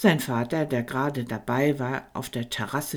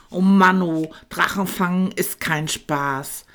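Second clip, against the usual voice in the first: louder, scolding little dragon; 5 semitones higher, 7 dB louder than usual. A narrator's reading. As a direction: tell them off sharply. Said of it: "schimpfte Tankino". (kleiner Drache schimpft laut mit den Jungen)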